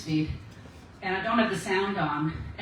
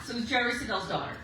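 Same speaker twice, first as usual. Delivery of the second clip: usual speaking voice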